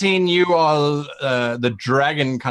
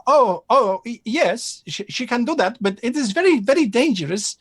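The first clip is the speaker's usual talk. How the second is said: high nervous merchant; 10 semitones higher than usual. Acted. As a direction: voice jumps high, hesitant. GM voices Al Qasim.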